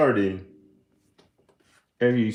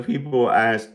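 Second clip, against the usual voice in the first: ordinary talk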